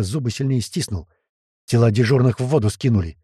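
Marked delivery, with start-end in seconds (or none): none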